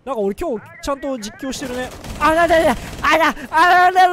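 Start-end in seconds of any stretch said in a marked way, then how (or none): 2.2-4.1 loud startled あららら, slowing down. Real, not acted. Caught off guard in a firefight, a loud startled cry. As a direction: blurt it out as the enemy appears. Sudden danger in the fight.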